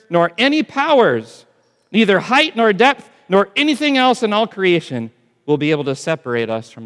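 high, loud Bible reading; rising scripture proclamation. Acted. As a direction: build the verse like a crescendo.

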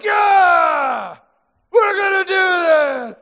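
loud, high triumphant shout; excited war cry. Retold or imitated. Preacher playing the army's loud war cry. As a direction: yell it like a confident army. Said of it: "They were like".

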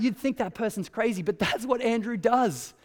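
usual speaking voice

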